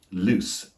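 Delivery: LOOSE ending in a crisp S; voiceless ending. Shown as a demonstration. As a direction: say loose as "looss", no buzz. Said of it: "with a S sound".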